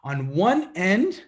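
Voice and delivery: ordinary talk, nothing marked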